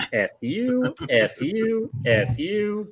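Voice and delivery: very high, mock defiant send-off; Office Space quitting bit. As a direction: point out each coworker in a high, comic voice. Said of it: "do the Office Space".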